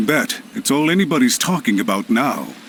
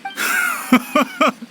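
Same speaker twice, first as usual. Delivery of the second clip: mocking laugh. Villain mocking Ayato.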